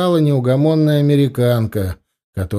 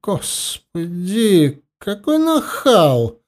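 slow, indignant hissing; hissed; 8 semitones higher, much slower than usual. Acted. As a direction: hiss it out offended. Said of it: "прошипела она".

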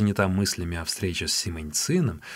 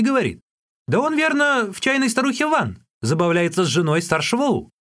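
high, animated account of a grievance; Юньге, aggrieved storyteller; 9 semitones higher, 3 dB louder than usual. A narrator's reading. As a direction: recount it eagerly in a high voice.

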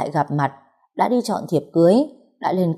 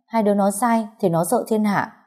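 ordinary delivery

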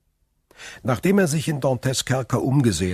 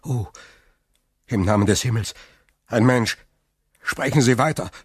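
louder, higher cry of shocked hope; startled and excited; 3 semitones higher, 5 dB louder, faster than usual. A narrator's reading. Prisoner answers the unseen voice in startled excitement. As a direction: blurt it out, stunned that someone is there. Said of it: "vor Schreck die Haare".

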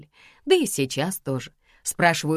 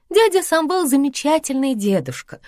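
high, loud exclamation; exclaiming; 12 semitones higher, 6 dB louder than usual. A narrator's reading. Lika defends the uncle to Karina, exclaiming. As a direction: exclaim it in raised pitch. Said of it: "воскликнула Лика".

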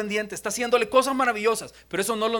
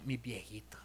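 very soft, low, near-whisper; tender pet name; 8 semitones lower, 17 dB quieter than usual. Acted. Grown child naming the parents affectionately, hushed. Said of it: "ya llama a los papás así como".

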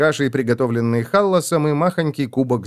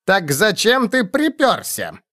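slow, high question with «припёрся» pointedly set apart; ударение на «припёрся»; 7 semitones higher, slower than usual. A narrator's reading. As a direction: lean deliberately on «припёрся». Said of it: "специально выделил последнее слово". (нарочито выделить «припёрся»)

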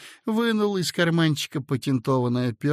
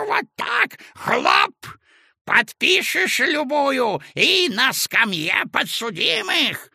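very high, cackling, drawn-out taunt; old man tossing papers with a shrill jeer; mocking old man; 13 semitones higher, slower than usual. A narrator's reading. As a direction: sneer like a mischievous old spirit. Lustrinovy taunts, throwing papers.

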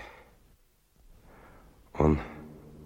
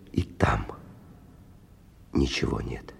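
much lower, breathless whisper; stunned whisper; 6 semitones lower, 7 dB quieter than usual. Acted. Stalker whispering to himself over the empty canisters. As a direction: breathe it out, barely voiced, in disbelief.

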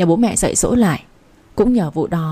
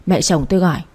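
ordinary delivery, nothing marked

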